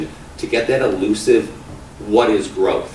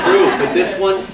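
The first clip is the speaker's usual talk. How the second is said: laughing; 12 semitones higher, 5 dB louder than usual. Real, not acted. Laughing at the acronym joke.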